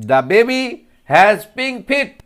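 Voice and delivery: teacher modelling the line; slow modelled sentence. Shown as a demonstration. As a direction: draw out feet for the class. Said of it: "ফিটের উচ্চারণটা দেখো".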